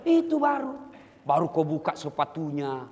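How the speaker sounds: usual speaking voice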